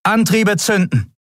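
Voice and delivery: high, ringing order; loud command. A narrator's reading. Captain orders the crew to fire the engines, loud. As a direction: call it out to the crew. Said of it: "befahl laut".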